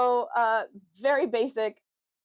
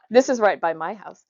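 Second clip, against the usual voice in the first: ordinary delivery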